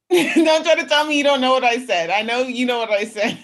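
loud, high retort through laughter; laughing protest. Retold or imitated. Retelling her comeback to confused listeners, laughing protest. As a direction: laugh while mock-scolding the listener.

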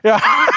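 very high squeal of a laugh. Real, not acted. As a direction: burst into a squeaky, delighted laugh. Excited laugh at shared joke.

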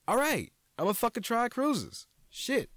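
high, exasperated surrender; high mock surrender to the nagging; mock giving in. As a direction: throw up your hands and give in. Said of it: "giving in to everyone".

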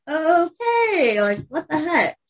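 confused high "okay"; puzzled, rising disbelief; surprised. Retold or imitated. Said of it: "they're like".

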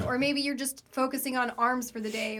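normal talking voice

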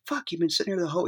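high, quick disbelieving exclamation; retold amazement. Actor trying to get back into character, amazed. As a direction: imitate an actor too amazed to refocus. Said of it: "he's trying to get back into character".

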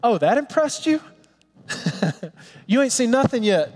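Jesus playfully teasing Nathanael, amused. Retold or imitated. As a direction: tease him with a grin. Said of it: "And Jesus says".

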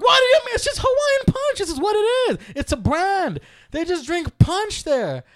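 very high, loud exasperated rant. Retold or imitated. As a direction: yell the explanation as if it were obvious. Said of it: "I started raising my voice".

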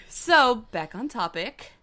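ordinary talk

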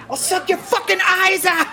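hammed-up high Pesci yell, cracking up; Pesci impression. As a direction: squeal the threat through laughter, imitating Pesci. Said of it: "when he beats the fucking shit out of the guys".

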